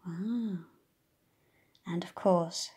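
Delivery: plain reading voice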